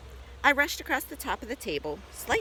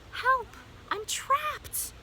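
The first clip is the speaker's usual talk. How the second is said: very high, squeaked cry for rescue; squeaky yell for help; much slower than usual. A narrator's reading. Hamster trapped under the bag, squeaking a yell. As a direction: squeal it like a trapped small animal. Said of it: "What I could do was yell".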